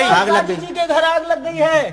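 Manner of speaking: high-pitched crier's call; raising the alarm. Acted. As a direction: call out high to alert the neighbours. Bystander raises the fire alarm.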